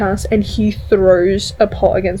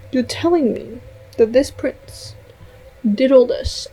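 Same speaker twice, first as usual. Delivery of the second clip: ordinary talk, nothing marked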